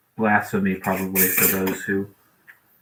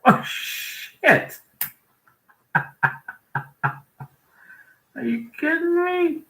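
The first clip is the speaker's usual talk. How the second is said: startled; slower than usual. Real, not acted. Startled by something on screen.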